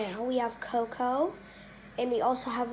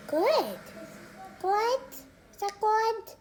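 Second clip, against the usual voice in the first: sweet praise to pet; 7 semitones higher, much slower than usual. Real, not acted. Praising bird while feeding.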